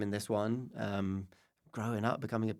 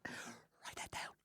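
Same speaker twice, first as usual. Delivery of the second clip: whispered slow aside; hushed, slow joking whisper; 18 dB quieter, much slower than usual. Real, not acted. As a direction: breathe it out slowly as a secret.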